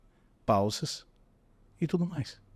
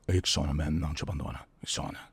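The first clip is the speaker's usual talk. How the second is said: lower, softer ad voice; deep ad jingle read; 7 semitones lower, 3 dB quieter than usual. Retold or imitated. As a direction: croon the slogan low. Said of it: "mais ou menos assim". (locução grave de comercial)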